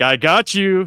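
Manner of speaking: a villain crowing over a hit; loud smug villain taunt; loud gloating taunt. Retold or imitated. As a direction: bark out a smug "I got you". French villain taunts Jet Li.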